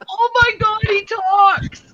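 very high-pitched mock astonishment; imitating people amazed by a talking bird; amazed onlookers, imitated. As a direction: squeal it like a thrilled bystander. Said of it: "People are just like".

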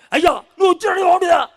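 high, loud reproachful shout; Martha shouting. Acted. Martha shouting at Jesus for arriving late. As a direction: yell it at Jesus, scolding. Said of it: "అని అరిచింది".

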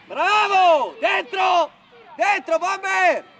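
urgent sideline shouts to players; shouted coaching calls. Real, not acted. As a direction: call out across the pitch, stretching the last word. Coach calling to players mid-match.

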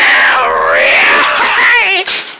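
long, drawn-out victory yell; stretched celebratory holler; triumphant cry. Acted. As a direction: let out a big gloating whoop. Victory cry after boast.

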